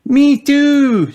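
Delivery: very high, loud squeal; high-pitched childish chorus cry; shouting children. A narrator's reading. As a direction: shout it like eager little friends. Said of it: "shouted all of Peppa's friends".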